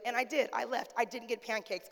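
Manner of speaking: normal talking voice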